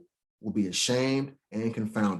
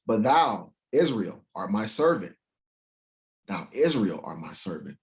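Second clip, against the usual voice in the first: scripture reading; 3 semitones lower than usual. Acted. Preacher reading scripture to the congregation. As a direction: read it out like holy writ.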